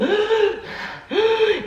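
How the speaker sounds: sharp high panicked gasp; re-enacted gasp of panic in the car; gasping for air. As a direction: gasp like you cannot get air in. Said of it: "I was going".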